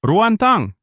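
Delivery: sharp, very high outraged call of her name; angry call. Acted. Father confronts daughter publicly.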